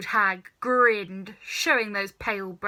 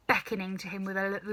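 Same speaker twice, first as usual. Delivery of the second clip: plain reading voice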